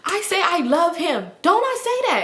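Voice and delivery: high-pitched mock pleading; high-voiced appeal for backup; retold pleading. Retold friend pleading with Simone.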